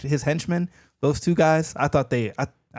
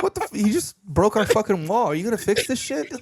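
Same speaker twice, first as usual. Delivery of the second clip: higher, louder outraged homeowner; mock angry homeowner; 9 semitones higher, 4 dB louder than usual. Co-host voicing the furious couple at the fake plumber. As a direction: yell at the fake plumber about the wall. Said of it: "It's like".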